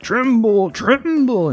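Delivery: high, booming pirate king; big scary pirate voice. Retold or imitated. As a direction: play it as a menacing pirate boss. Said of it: "in, like, some big scary pirate voice".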